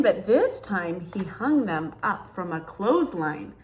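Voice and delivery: put-on lively read-aloud for children; storybook reading. Reading a picture book to kids, storytelling voice.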